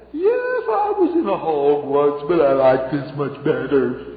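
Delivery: slow, pleased little demon; smug demon voice. Retold or imitated. Demon answering the pastor's question, slow and smug. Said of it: "He said".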